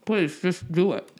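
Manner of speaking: talking through a mouthful of scone; words round a bite of scone; mouth full. Real, not acted. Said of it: "I'm eating a scone with clotted cream".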